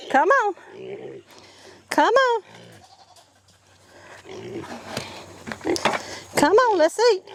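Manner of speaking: calling the pigs. Real, not acted. Calling pigs to their feed, high sing-song call. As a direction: coax the animals in with a high call.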